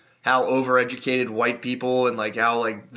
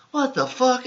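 mock shock, pitched way up; 11 semitones higher, slower than usual. Lyft driver hearing the theory, mock shocked high squeak. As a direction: imitate a stunned driver's squeak.

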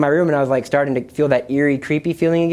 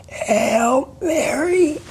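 high, strangled, very slow words; re-enacted straining against being choked; choked speech; 12 semitones higher, much slower than usual. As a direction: force the words out through a gripped throat. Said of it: "it was that I couldn't speak".

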